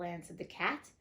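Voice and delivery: ordinary delivery, nothing marked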